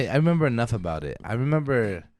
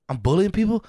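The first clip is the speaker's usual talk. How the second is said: indignant; 7 semitones higher, 4 dB louder than usual. Retold or imitated. Son protesting to dad.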